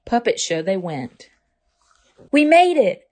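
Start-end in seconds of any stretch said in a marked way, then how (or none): 2.3-3.1 high, loud joyful exclaim. Acted. Ava exclaiming as they reach the theater. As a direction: burst out with relief and joy. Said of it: "Ava exclaimed".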